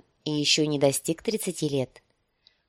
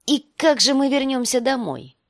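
angry; 8 semitones higher, 4 dB louder than usual. Acted. Viserys repeats in anger.

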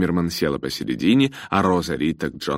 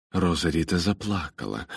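plain reading voice

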